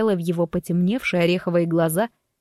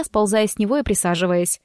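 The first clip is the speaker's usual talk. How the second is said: ordinary delivery, nothing marked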